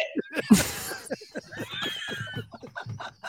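high, wheezing real laughter; helpless laughing fit. Real, not acted. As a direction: crack up after your own rant. Cracks up after own rant.

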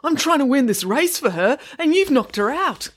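high, indignant outburst; demanding. A narrator's reading. Boy confronting Tom over knocked-out girl, demanding. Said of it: "he demanded".

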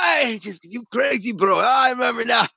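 high, excited shout of recognition; suddenly placing an old face, thrilled. Retold or imitated. Rod recognizing an old jail friend, excited high shout.

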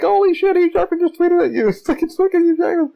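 imitated high-pitched squealing disbelief; friends freaking out. Friends reacting to the screenshot, high freaked-out squeals. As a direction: mimic excited friends, voice way up high. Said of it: "they're freaking out".

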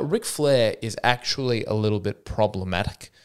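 usual speaking voice

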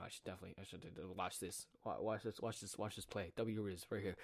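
hushed thought spoken softly to himself; quiet inner monologue. Retold or imitated. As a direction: murmur it like a thought in your head. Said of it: "within my head".

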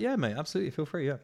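eager. Retold or imitated. Eager agreeing reply.

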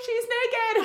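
very high, loud mock shriek; high-pitched comic warning; alarmed call. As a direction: imitate a panicked call through the door. Holly calling back through door.

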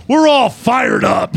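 slow, loud rallying shout; retold fired-up shout. Quoting the press comment with a slow loud shout. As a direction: yell it like a pumped-up spokesman. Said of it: "he says".

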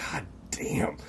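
breathless whisper of awe. Real, not acted. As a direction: whisper it, stunned. Awed at the girl's looks.

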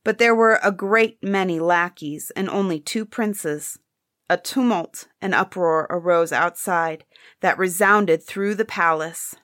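usual speaking voice

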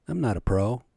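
ordinary delivery

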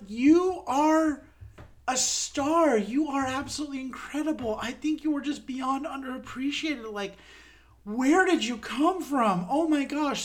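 high-pitched, starstruck gushing; gushing admiration. Retold or imitated. Fan telling a stage actress she is a star, gushing. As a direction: heap praise in a high, amazed voice. Acts out gushing praise to performer.

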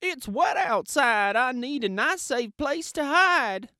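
high hen voice. A narrator's reading. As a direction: chirp it like a nervous hen. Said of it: "said Hen".

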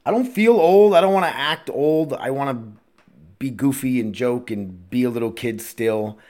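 ordinary delivery

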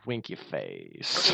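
deep deadpan. Acted. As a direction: drop low and drawl it out. Joke deadpan.

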